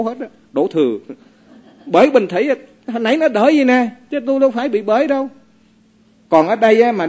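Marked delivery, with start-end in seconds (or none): 1.9-5.3 mock whiny blame, higher and quick; blaming spouse. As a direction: sulkily shove the blame away. (giọng đổ thừa nhại lại)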